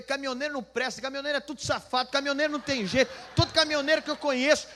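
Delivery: high, loud rant, building; mock angry rant. Pastor mirroring the man's rant against truckers, loud mock indignation.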